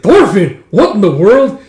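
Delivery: shocked exclamation. Acted. Father finding his son aboard, shocked. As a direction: exclaim at a stowaway.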